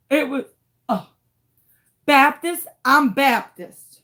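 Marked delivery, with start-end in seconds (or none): none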